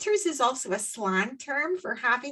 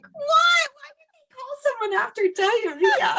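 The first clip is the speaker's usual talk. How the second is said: very high, loud disbelief breaking into laughter; incredulous reaction; 4 dB louder than usual. Retold or imitated. Host retelling her reaction to the skittery winter, incredulous and laughing. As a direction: squeal the baffled question while cracking up. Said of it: "And then I'm like".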